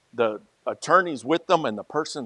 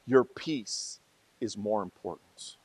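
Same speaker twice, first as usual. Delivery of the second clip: soft and slow; 4 semitones lower, 9 dB quieter than usual. Real, not acted. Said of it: "repeated for emphasis".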